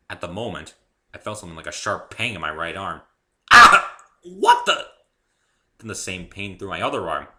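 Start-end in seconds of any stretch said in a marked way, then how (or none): 3.5-4.0 sudden high, loud yelp of pain; pained cry. Acted. Narrator feels sharp pain. 4.2-4.9 high, loud startled gasp of words; shocked outburst. Acted. Shocked by pain.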